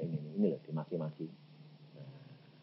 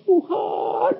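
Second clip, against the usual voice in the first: high, wailing mock sob to God; hammed-up weeping cry; imitated crying; 3 dB louder, slower than usual. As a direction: act out a tearful plea, voice pitched way up. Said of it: "wuh, nangis".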